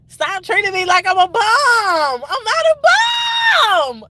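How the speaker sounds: high, loud wail, "bum" stretched out; building to a drawn-out high shout; dramatic wailing protest. Real, not acted. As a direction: cry out in hammed-up outrage, holding "bum". Dramatic venting about being treated badly.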